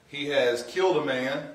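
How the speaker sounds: ordinary talk, nothing marked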